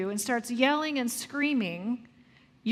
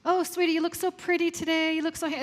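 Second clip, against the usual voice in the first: put-on sweet, higher gushing praise for a child; gushing parent; 5 semitones higher, faster than usual. Parent gushing at child about looks, sweet and higher. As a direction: coo over how pretty she looks. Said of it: "Should we focus on what they look like?".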